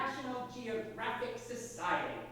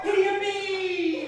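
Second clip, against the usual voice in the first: excited shout; 10 semitones higher, 10 dB louder than usual. Acted. Boy sledding, shouting with joy.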